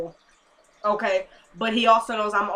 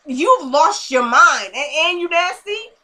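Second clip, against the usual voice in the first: loud, high-pitched disbelief; hammed-up shock; appalled outburst; 5 semitones higher, 6 dB louder, slower than usual. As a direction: exclaim it, scandalized and slow. Acting out her own shocked reaction.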